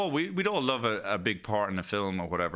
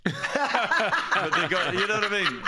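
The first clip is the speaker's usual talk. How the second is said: talking through laughter; laughing; 9 semitones higher, 4 dB louder, slower than usual. Real, not acted. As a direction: laugh while you say it. Laughing mid story.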